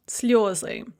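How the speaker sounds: "sliozy" with a stressed yo in the first syllable; Russian "sliozy", full yo under stress. Shown as a demonstration. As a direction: say "sliozy" like SLYO-zy. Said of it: "really a yo".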